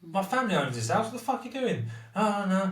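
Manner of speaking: mock outraged objection; indignant retort. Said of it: "And I said".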